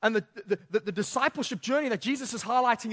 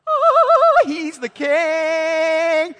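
very high, loud swooning cry; cheering crowd; 5 semitones higher, 11 dB louder, much slower than usual. Retold or imitated. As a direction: gush like an adoring fan. Crowd cheers imagined Jesus.

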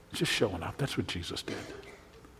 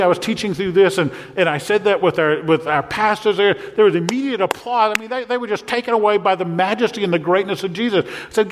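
normal talking voice

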